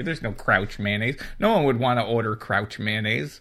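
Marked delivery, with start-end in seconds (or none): none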